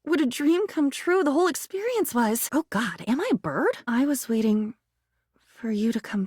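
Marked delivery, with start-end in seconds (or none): none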